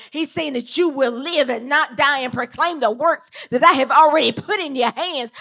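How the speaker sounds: loud, driving preacher's cadence; fiery preaching. Acted. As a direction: preach it out full force to the church.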